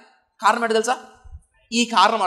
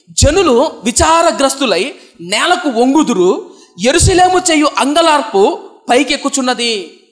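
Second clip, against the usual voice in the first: slow, louder lament-like Bible reading; solemn scripture reading; 3 semitones higher, 4 dB louder than usual. Shown as a demonstration. Preacher reads the lament of Judah to the congregation, slow and louder. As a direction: draw out the verse slowly with mounting weight. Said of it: "ఇప్పుడు చూద్దాం కింద ఏమంటారు".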